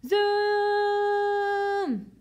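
very long, high, loud zoom; full-speed zoom. A narrator's reading. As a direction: sustain it like a racing engine. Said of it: "down the street in ninety-second gear".

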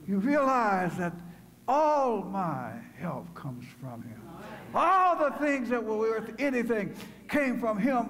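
high, loud, stretched-out preaching cry; full-force preaching. Acted. Preacher exhorting the congregation at full voice.

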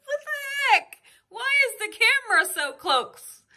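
high amused exclamation; amused disbelief. Real, not acted. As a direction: squeal at the camera in disbelief. Amused at camera closeness.

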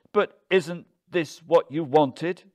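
loud, high, slow challenge; heated, emphatic frenzy; frenzied. A narrator's reading. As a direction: demand it of them, drawn out and loud. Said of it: "in something of a frenzy".

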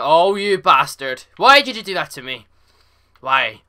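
loud indignant yell; angry outburst. Real, not acted. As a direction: shout at the other driver. Driver cuts him off in game.

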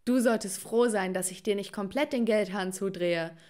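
ordinary delivery

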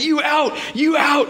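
put-on high-pitched farmer ordering kids out; two sharp, high mock shouts; mock yelling. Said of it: "he's just yelling at kids".

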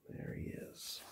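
hushed aside to the listeners; whisper. Real, not acted. Dad whispering an aside to his kids. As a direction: breathe it out softly.

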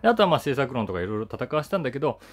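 ordinary talk

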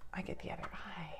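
a fast, excited whisper; quick whisper. Acted. Parent claiming another eye in the game, quick whisper. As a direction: hurry it out under your breath. Playing beetle dice game with Adele.

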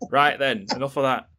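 a hint of a no-nonsense general; Jason Isaacs general voice. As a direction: bark it like a blunt war hero. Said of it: "And he talks like this".

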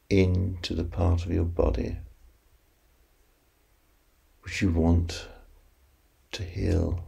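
slow counting hypnosis voice; guided sleep meditation. Acted. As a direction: ease them toward sleep, unhurried.

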